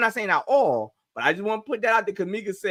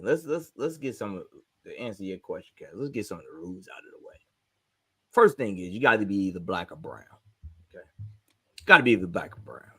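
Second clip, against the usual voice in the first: quiet and low, like reading house rules; 12 semitones lower, 6 dB quieter than usual. Real, not acted. To the group, laying out the pause rules, low and quiet.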